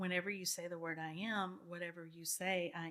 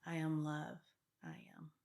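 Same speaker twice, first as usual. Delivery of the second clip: affirmation demo; 3 dB louder than usual. Shown as a demonstration. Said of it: "So I say things like".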